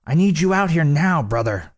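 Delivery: high, urgent call through the door. A narrator's reading. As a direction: start high and press him urgently. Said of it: "in such an urgent voice".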